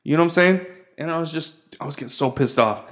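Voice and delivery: normal talking voice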